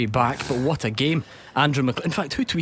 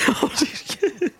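squealing burst of laughter; real giggle fit; high helpless laugh; 12 semitones higher than usual. Real, not acted. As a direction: crack up mid read. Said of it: "Just couldn't do it".

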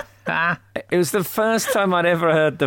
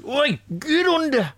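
high-pitched holler at a dog; gruff-owner dog command; 13 semitones higher than usual. Shown as a demonstration. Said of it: "school of speaking to dogs".

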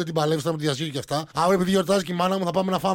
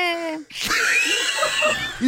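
high shrieking laugh; real laughing fit; 11 semitones higher than usual. Real, not acted. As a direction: crack up completely at the joke. Bursts out laughing.

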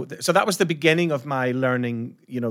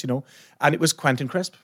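ordinary talk

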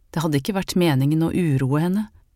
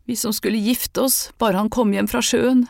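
high, tearful lament; crying; 6 semitones higher than usual. A narrator's reading. Sofie crying to her friends about lost wedding plans. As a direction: weep the words out. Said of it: "gråt Sofie".

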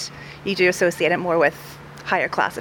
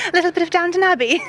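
high-pitched, quick posh English accent; 10 semitones higher, 3 dB louder, faster than usual. Shown as a demonstration. Acting student showing off on request, playful posh accent. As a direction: playfully put on received pronunciation, pitched up. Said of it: "a posh accent".

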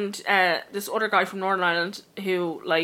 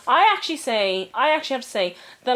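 ordinary talk

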